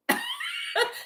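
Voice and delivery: very high short laugh; squeal of laughter. Real, not acted. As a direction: let out a squeal.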